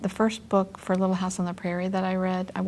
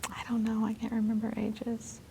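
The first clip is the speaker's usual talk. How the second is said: whispering; 3 semitones higher, 6 dB quieter than usual. Real, not acted. Aside while trying to recall.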